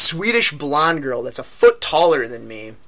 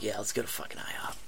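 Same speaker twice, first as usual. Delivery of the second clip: put-on soft drunken whisper, fast; 6 semitones lower, 17 dB quieter, faster than usual. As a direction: breathe it like a tipsy secret. Drunk friend agreeing to food.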